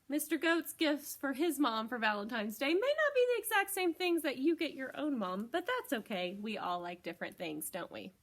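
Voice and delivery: usual speaking voice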